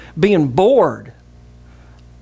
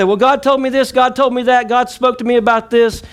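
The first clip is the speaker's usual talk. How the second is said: pitched-up mock voice of self-assured believers; mocking quote; 8 semitones higher than usual. Preacher parodying people who claim God told them, high and mocking.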